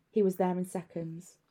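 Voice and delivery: plain reading voice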